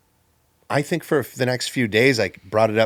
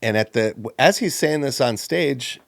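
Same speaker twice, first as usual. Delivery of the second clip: ordinary talk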